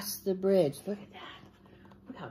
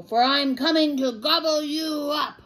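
high-raised, menacing troll yell; 8 semitones higher, 5 dB louder than usual. A narrator's reading. Troll threatens to gobble the smallest goat, yelling. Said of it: "he's yelling at him".

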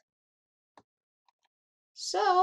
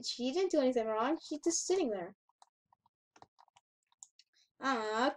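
normal talking voice

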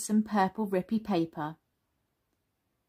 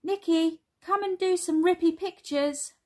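put-on high, louder child's call; high, singled-out storybook child voice; 9 semitones higher, 4 dB louder than usual. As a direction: call a little cousin over. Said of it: "Zula calls".

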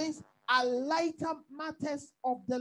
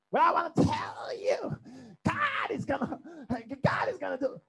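American preacher. Acted. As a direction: mimic a hyped-up American preacher. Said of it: "American preachers get away with that".

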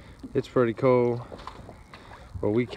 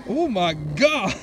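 drawn-out, high astonished cry; real shock; 6 semitones higher, 4 dB louder, much slower than usual. Real, not acted. Fisherman seeing a huge fish fight the line, slow stunned cry. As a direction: stretch it out in disbelief. Big fish pulls under boat.

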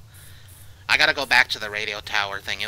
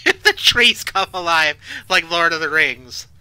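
loud, raised-pitch protest; agitated ranting; 7 semitones higher, 10 dB louder, slower than usual. Real, not acted. Player warns about trees coming alive, loud agitated rant.

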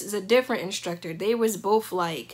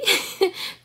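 light laugh; 11 semitones higher than usual. Real, not acted. Laugh mid-story.

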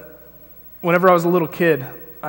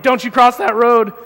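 louder, higher shout of an alarmed grandma; grandma's warning yell; 5 semitones higher, 7 dB louder than usual. Retold or imitated. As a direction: yell the warning across the road. Grandma yelling warning at child.

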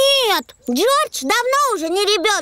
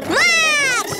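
starter's shout; 7 semitones higher than usual. Acted. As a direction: bark it like a race starter. Peppa starts the race.

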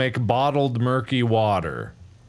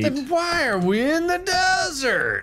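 high, drawn-up squawk of a question; high-pitched outcry; 12 semitones higher than usual. Acted. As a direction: ask it way up high, exasperated. Exasperated question in game banter.

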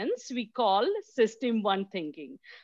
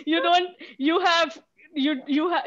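laughing while talking; 8 semitones higher than usual. Real, not acted. Still laughing after answers.

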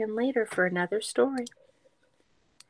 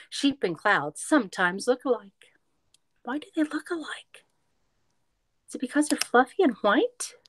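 lively storybook read-aloud; reading to children; 4 semitones higher, 4 dB louder than usual. A narrator's reading. Teacher reading a picture book to kids, lively read-aloud.

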